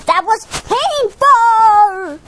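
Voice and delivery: slow, drawn-out protest; pained complaint. Pretend. Toy tells Twilight off, pained complaint.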